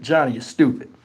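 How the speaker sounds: low, soft mock dismissal; hushed, lightly amused put-down; imitated amused dismissal. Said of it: "laughing like".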